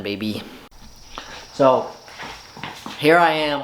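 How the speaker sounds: ordinary talk, nothing marked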